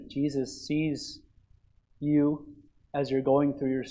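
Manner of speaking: soft, low, slowed, hushed comfort; tender reassurance. Acted. Preacher gently assuring the congregation of God's care, hushed. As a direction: lean in and speak gently to hurting listeners.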